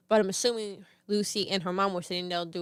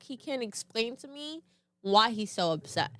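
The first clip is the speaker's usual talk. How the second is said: usual speaking voice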